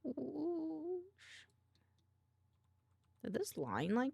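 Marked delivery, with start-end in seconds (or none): 0.0-1.0 high, rising drawn-out whoosh. Real, not acted. Retrying the sound after the voice broke, rising whoosh. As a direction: glide upward into a long "sh".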